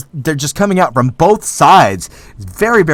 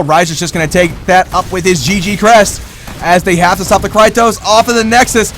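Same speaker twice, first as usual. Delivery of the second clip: ordinary delivery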